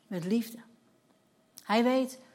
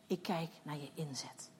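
whisper; 6 semitones lower, 11 dB quieter than usual. Retold or imitated. God speaking to her softly.

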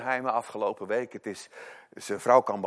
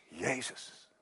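zacht; 9 dB quieter than usual. Acted. Climax of preaching, name dropped soft.

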